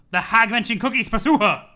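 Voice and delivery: rally the charge loudly; mock battle cry. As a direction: narrator urging John after the cookies, loud. Mock command to chase.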